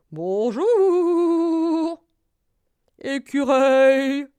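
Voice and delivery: loud, high, long-drawn hoot; hooting owl. A narrator's reading. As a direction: stretch the vowels like a hooting owl. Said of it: "ulula le hibou". (ululement de hibou, fort et traînant)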